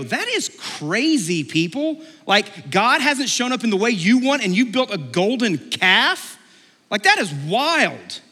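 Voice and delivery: high, swooping disbelief; mock incredulity. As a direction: stretch the key words in scandalized amazement. Mimicking our judgement of Israel.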